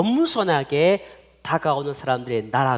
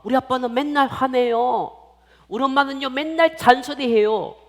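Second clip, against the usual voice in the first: put-on high, whiny child's grumble; complaining child; 8 semitones higher, 4 dB louder than usual. Children complain about dad and mom, high-pitched grumble. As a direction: tell on your parents in a raised voice.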